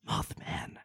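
raspy breathless whisper on the name; hoarse whisper. Shown as a demonstration. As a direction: rasp out Mothman under your breath. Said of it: "like, hoarse whisper".

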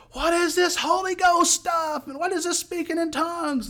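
high-pitched yell over loud music; yelling over noise. Retold or imitated. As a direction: shout the question over a blasting band. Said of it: "having to kinda yell when you talk".

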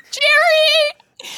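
very high squealed call; crying out. Real, not acted. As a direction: squeal the name out in protest. Calling out to Jerry.

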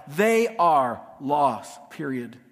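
ordinary talk

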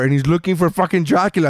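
usual speaking voice

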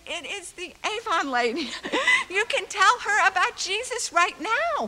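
put-on high, loud child's call upstairs; excited child calling. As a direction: call out like an eager little girl. She runs upstairs.

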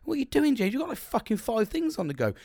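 exasperated yelp at his own chaos; scolding himself. Retold or imitated. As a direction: tell yourself off. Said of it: "I was like".

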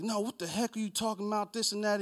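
lightly mocked outrage; mock indignation. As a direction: protest it as the offended man. Mimics indignant man arguing back.

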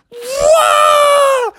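long high "whoa" scream; falling scream. A narrator's reading. Two friends drop over the waterfall, falling scream. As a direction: scream as you plunge over the edge.

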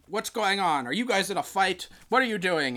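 lightly voiced officer demanding answers; mock-indignant superior; commanding officer. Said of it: "commanding officer guy, and he's like".

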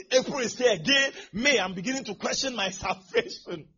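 loud, high preaching cry; full-force preaching. Real, not acted. Preacher exhorting the crowd at full force.

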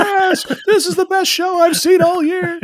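high, hammed-up rave; mock audience delight, pitched high; gushing audience member. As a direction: cheer like a thrilled theatergoer. Theater audience cheering.

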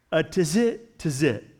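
"tizit" carefully sounded out as tih-ZIT, twice; careful pronunciation. Shown as a demonstration. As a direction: say "tizit" twice, first rising, then falling. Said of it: "look at the pronunciation here so I don't butcher it".

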